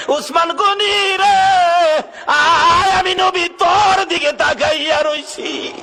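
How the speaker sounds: high, drawn-out preaching cry; calling out. Acted. The Prophet summoning Usman to him, calling out. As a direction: cry the name out long and loud. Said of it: "দুইটা হাত বাড়াইয়া ডাক দিলেন".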